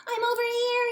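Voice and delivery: high, loud answer; yelling. Acted. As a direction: yell back through the rain. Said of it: "he yelled".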